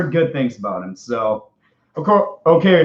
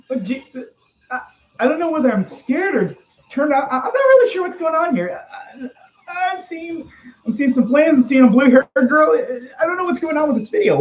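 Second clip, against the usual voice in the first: higher, rattled and stammering; scared rambling; 9 semitones higher than usual. Acted. Watching a scary video on stream, higher frightened stammer. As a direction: babble nervously, pitched up in panic.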